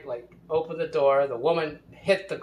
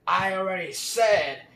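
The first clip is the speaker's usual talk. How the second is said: louder, higher retold yell; imitated angry scream; 6 semitones higher, 6 dB louder than usual. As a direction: mimic his bitter, screamed comeback. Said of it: "He screamed".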